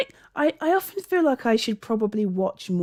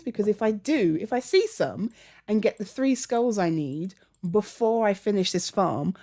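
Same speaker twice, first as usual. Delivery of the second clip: normal talking voice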